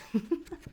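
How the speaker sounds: a brief burst of amused laughter; real laugh. Real, not acted. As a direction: laugh that slips out mid-reading. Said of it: "I'm sorry for laughing".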